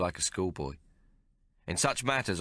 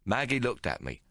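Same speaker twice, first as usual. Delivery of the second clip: ordinary delivery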